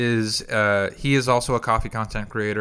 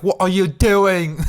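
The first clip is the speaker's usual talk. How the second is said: slow, loud, high British judge impression; mock an appalled English talent-show judge; Simon Cowell; 8 semitones higher, 6 dB louder, slower than usual. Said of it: "like, Simon Cowell to be like".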